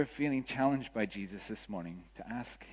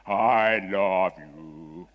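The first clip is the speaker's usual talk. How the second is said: loud, lower, drawn-out masculine God voice; booming heavenly voice; 5 semitones lower, 9 dB louder, much slower than usual. Acted. Preacher mocking how God is imagined, in a booming masculine voice. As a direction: boom it out slowly like a man's voice from heaven. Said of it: "booming masculine voice from heaven".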